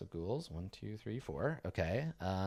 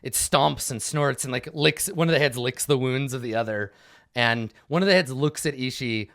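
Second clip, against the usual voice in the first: normal talking voice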